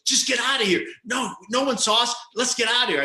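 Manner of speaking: high-pitched imitation of a panicking passenger; panicky urging. Lead singer pressing the driver to leave the accident, panicky. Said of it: "The whole time goes".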